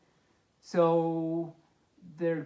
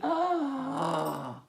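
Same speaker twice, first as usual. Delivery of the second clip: gasp; 7 semitones higher than usual. Acted. Reader gasps at the train.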